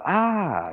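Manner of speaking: sharp high yelp of pain; pained cry. Retold or imitated. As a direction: cry out as you hit the ground. Falls off motorcycle, cries out.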